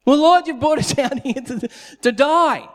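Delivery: high, loud whining with a laugh; mock the people's whining; whiny complaint. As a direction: people whining back at God, high and mocking. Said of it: "people whining to God".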